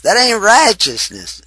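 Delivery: loud, high southern twang on righteousness; southern accent. Shown as a demonstration. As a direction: preach it hard with a southern accent. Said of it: "That's my southern accent".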